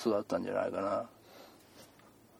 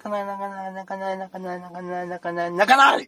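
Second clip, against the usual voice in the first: higher sing-song don't-cry; comforting child; 7 semitones higher, 4 dB louder than usual. Acted. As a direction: hush a crying kid, repeating. Calming crying child.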